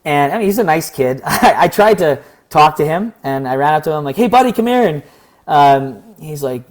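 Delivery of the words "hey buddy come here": high, loud, friendly hail to a stranger; friendly call-out. Retold or imitated. Calling a counter protester over, friendly loud call. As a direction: call him over like an old pal.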